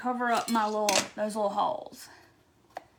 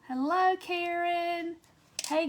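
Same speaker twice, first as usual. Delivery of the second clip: ordinary delivery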